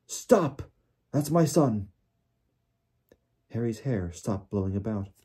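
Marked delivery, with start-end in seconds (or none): 0.1-1.9 high, urgent father's cry; yelled plea. A narrator's reading. Father shielding his son from wizards, yelling. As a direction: yell it high and desperate. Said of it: "yelled the voice he recognized".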